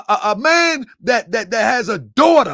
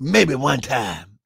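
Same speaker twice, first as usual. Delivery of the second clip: mocking impression; 7 semitones lower, slower than usual. Mocking the clip's evasive answer.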